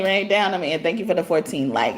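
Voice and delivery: ordinary talk, nothing marked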